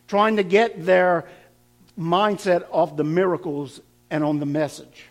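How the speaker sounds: ordinary talk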